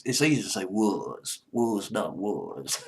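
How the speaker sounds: slow, drawn-out Southern accent on was not was. Shown as a demonstration. Radio host showing off his Southern accent on the band name. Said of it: "Try to get my southern accent out".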